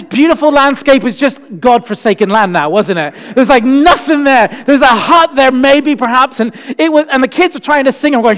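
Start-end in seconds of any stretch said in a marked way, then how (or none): none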